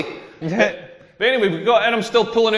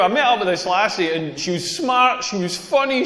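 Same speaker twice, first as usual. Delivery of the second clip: ordinary talk, nothing marked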